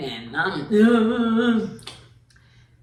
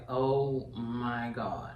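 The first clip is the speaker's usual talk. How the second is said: very slow, soft, lower dread; overwhelmed on stage; 6 semitones lower, 8 dB quieter, much slower than usual. Retold or imitated. Walking out on stage, slow soft dread. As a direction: drag it out in quiet panic. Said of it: "you walking out there and you like".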